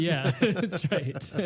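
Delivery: amused, laughing as you concede the point; laughing agreement. Real, not acted. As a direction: agree through laughter, drawn out slowly. Laughs along at host's joke.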